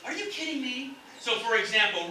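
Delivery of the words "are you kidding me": high, softer, pleased question; happy disbelief. Shown as a demonstration. Teacher showing the happy scenario, happily disbelieving. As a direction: lift the pitch in delighted disbelief. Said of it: "That is a happy scenario".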